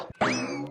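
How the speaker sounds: sly low laugh. Acted. Daughter-in-law gloats after victory.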